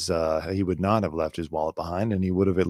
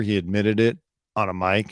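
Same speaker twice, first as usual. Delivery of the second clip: ordinary delivery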